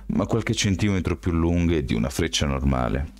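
usual speaking voice

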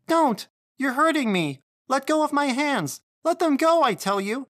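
very high, loud panicked cries; frantic high-pitched child's protest; screaming child. A narrator's reading. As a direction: scream to be let go. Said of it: "the child let out a scream".

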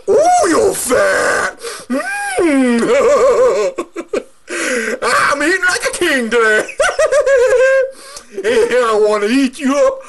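high, gloating troll who thinks he has found lunch; a greedy troll cackling about his meal; gleeful troll. Acted. Troll gloating at the fat goat, high and greedy.

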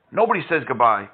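ordinary talk

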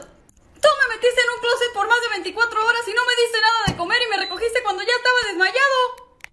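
high-pitched complaining man. Acted. Ricardo complains about closet.

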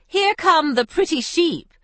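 high, loud teasing cry; mocking cry. Isabel mocking the pilgrims with a high, loud cry. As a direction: call out to gawking visitors with mock delight. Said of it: "she would cry".

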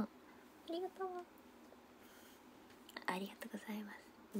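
softly whispered thanks. Real, not acted. Whispering thanks to a commenter. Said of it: "whispered thanks to viewer".

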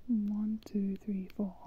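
soft whispered counting; whispering. Real, not acted. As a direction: count it under your breath. Counting spaces under her breath.

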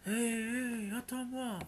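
high, loud monstrous hail; terrible ogress call. A narrator's reading. Ogress chasing the fleeing boy, high loud monstrous call. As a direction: yell after him like a hungry ogress. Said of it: "l'appelait de sa terrible voix".

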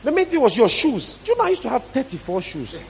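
high, impassioned preaching; fervent proclamation. Real, not acted. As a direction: pitch soaring on each refrain. Preacher contrasts old life with new.

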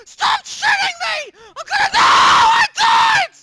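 high, loud screaming in panic; panicked screaming. Real, not acted. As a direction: scream as you die. Dying in game.

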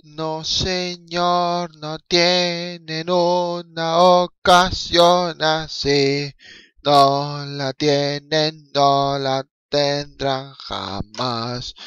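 very slow, stretched mock-solemn words; dragged-out verdict. Fan mocking the teams' missed chances, very slow drawled verdict.

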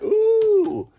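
high delighted ooh; surprised. Real, not acted. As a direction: squeal with delight at finding it. Finds the movie he wanted.

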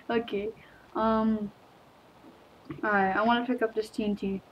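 ordinary talk, nothing marked

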